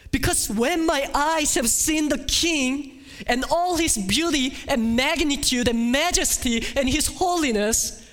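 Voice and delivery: high, loud, impassioned despair; loud high pleading lament; anguished preaching. Acted. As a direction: preach Isaiah's distress at full force. Isaiah's despair voiced at full force.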